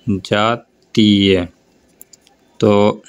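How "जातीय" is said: very slow with a falling end; teacher modelling the word slowly; slow demo word. Shown as a demonstration. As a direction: say जातीय slowly, syllable by syllable. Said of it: "यहाँ पे बताता हूँ".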